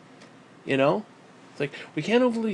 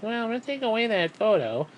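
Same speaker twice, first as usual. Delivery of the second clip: mocking the developers; 5 semitones higher, 3 dB quieter than usual. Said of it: "the fucking development team pussied out and is like".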